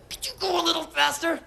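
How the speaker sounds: high, tight polite plea; strained polite request. Retold or imitated. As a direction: ask politely through clenched nerves. Pleading passenger.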